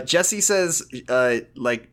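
normal talking voice

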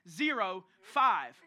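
ZERO FIVE very slow and punched; imitating an irked wife stressing ZERO, then FIVE; pointed emphasis. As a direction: drag out ZERO and FIVE, each one pointed. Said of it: "she said it with some emphasis".

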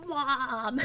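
put-on high whiny teen yell of Mom; teenage girl voice. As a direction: shout it like a sulky teenager. Echoing the teen voice.

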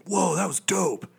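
amazed whoa. Retold or imitated. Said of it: "I was like".

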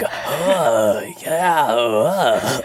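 lightly voiced wheezy demon lord; choking on smoke. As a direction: sound like he's choking on cigarette smoke. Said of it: "choking on his own cigarette smoke".

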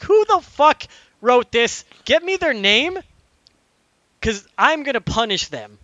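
loud, high, hammed-up indignation; mock outrage. Player demands the corny line's writer for punishment, mock-outraged. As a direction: demand it loudly, pitched well up.

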